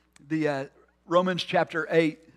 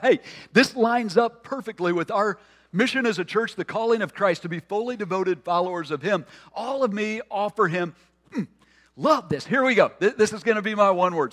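higher, louder retold eager thought; excited; 5 semitones higher, 5 dB louder than usual. Preacher retells his first thrill at the word, excited. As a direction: recall your own keen enthusiasm.

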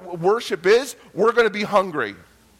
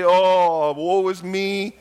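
drawn-out mock moan; hammed-up woe-is-me wail; self-pity; 3 dB louder, much slower than usual. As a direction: wallow in it, stretching the words slowly. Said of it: "walk in self-pity and just say".

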